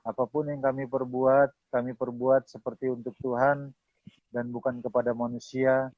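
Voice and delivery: subdued, measured closing prayer; public prayer. Real, not acted. Pastor closing the session before God, prayerful. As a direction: lead them in reverent prayer, phrase by phrase.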